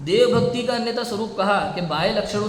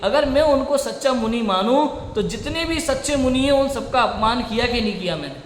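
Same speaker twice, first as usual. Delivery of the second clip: raised, insistent sermon voice; impassioned preaching; 4 semitones higher, 3 dB louder than usual. Acted. Preacher defending scripture to his audience, impassioned. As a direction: drive each point home to the hall.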